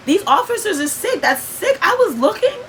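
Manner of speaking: high, heated burst of outrage; indignant outburst. Real, not acted. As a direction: voice jumps up in disbelief at the cruelty. Outrage at the officers' setup.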